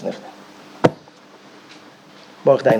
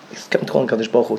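ordinary talk, nothing marked

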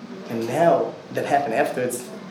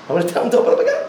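louder and higher with a laugh; excited, laughing; 10 semitones higher, 6 dB louder, much faster than usual. Retold or imitated. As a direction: land it with a delighted laugh. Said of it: "he says".

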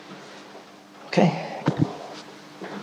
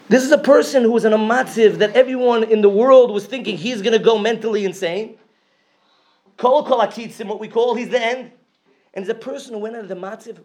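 high preaching, building then softening; 8 semitones higher than usual. Acted. Speaker preaching to the crowd about faith, fervent sermon. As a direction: preach it hard, then pull back.

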